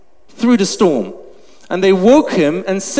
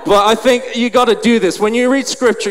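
normal talking voice

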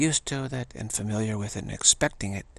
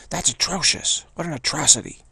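snappy, dismissive harpy shriek, higher and louder; 5 semitones higher, 6 dB louder than usual. Retold or imitated. Inner voice scorning a sublime experience, snappy harpy shriek. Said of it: "harpy kind of voice, very snappy, dismissing kind of voice".

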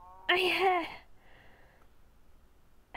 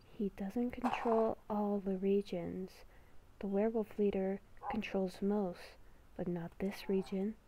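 beast within voice; 4 semitones lower, 10 dB quieter than usual. Acted. Inner beast whispering a warning to Haley, hushed and ominous. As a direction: whisper like a creature living inside her head.

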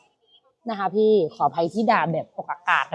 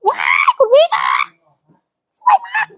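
much higher, shrieking praise; surprised shriek. Real, not acted. Host shrieks at the customer's pick, much higher. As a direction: shriek with delight.

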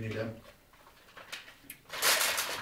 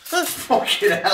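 high, slow, disbelieving cry; outraged surprise; 14 semitones higher, 3 dB louder, slower than usual. Real, not acted. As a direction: shriek it in mock outrage. Opens a joke present.

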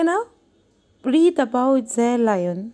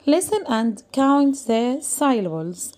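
very slow, clear classroom instruction; much slower than usual. Shown as a demonstration. Teacher reading the task to pupils, very slow. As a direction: spell out the task slowly. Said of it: "reading instruction to class".

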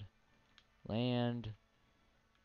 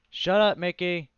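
yelling; 9 semitones higher, 15 dB louder than usual. Real, not acted. Yells at game character.

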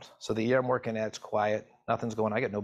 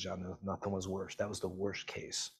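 hushed, somber; 3 semitones lower, 9 dB quieter than usual. Retold or imitated. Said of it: "She goes".

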